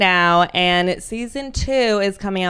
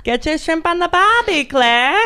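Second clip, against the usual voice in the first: higher, cheesy Australian, "barbie" stretched; terrible Australian accent; 7 semitones higher than usual. Acted. Host teasing her co-host Claire in a terrible Australian accent. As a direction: sell the cliché in a bad Aussie voice. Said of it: "terrible Australian accents".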